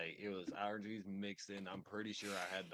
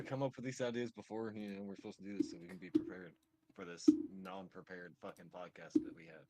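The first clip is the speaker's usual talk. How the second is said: ordinary delivery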